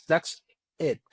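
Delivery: ordinary talk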